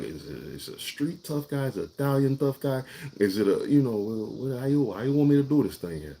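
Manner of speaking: lightly acted Italian tough guy. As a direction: play the tough guy a little. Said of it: "that's what I did. I did exactly just that".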